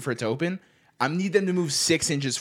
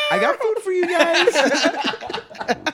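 very high, slow, laughing whine; high fake-crying plea to pets; mock whiny plea; 6 dB louder, much slower than usual. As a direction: whine it to the hungry cats. Pleading with yelling cats.

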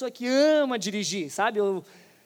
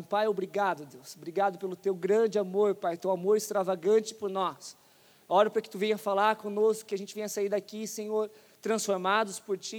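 prayer; 2 semitones lower, 4 dB quieter than usual. Acted. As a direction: pray quietly and earnestly. Leads the congregation in prayer.

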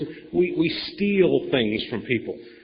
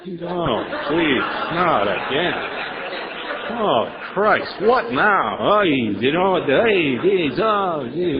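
mock exasperated sponsee; much slower than usual. Sponsee groans at a phone call from their sponsor, mock exasperated. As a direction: ham up a fed-up sponsee, drawing the words out slowly.

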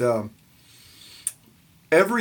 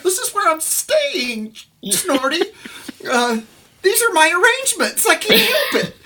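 put-on whiny kid reply; 3 dB louder than usual. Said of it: "And it's like".

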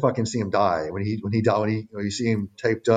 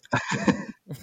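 high giggle; 3 dB quieter than usual. Real, not acted. Laughs at joke.

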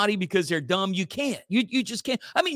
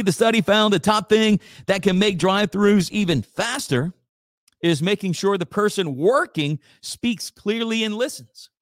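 ordinary delivery

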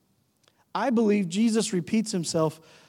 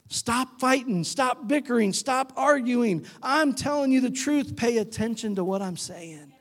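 higher-pitched, exasperated parent's commands; scolding parent; 5 semitones higher than usual. Acted. Parent telling kids to stop bickering, exasperated. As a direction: lay down each order like a fed-up parent. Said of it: "How many parents said that to their kids".

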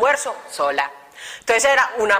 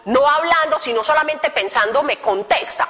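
ordinary talk, nothing marked

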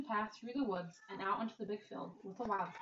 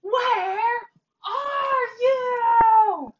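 full-voiced shout, very high and loud; loudest shout; 12 semitones higher, 18 dB louder, much slower than usual. A narrator's reading. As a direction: call out into the field with all her might. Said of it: "shouted in her loudest, loudest voice".

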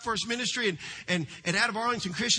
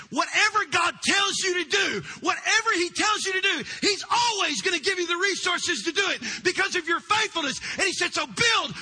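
prophetic shout; 7 semitones higher than usual. Retold or imitated. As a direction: thunder the promise out over the room. Prophet's word retold, shouted.

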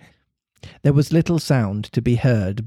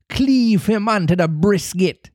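higher pirate cry that lacks force; cry without conviction; 8 semitones higher than usual. A narrator's reading. Hook ordering his crew against Peter, high cry without conviction. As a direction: shout the order but don't believe it. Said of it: "but without conviction".

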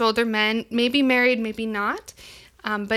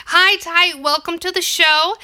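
excited; 6 semitones higher, 5 dB louder than usual. Real, not acted. Greeting guest excitedly.

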